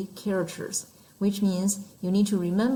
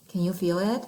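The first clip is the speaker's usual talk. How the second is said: usual speaking voice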